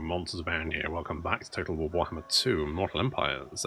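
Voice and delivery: low, soft seductive voice; sexy voice. Pretend. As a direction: purr it low and sultry. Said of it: "I'm doing it in a sexy voice".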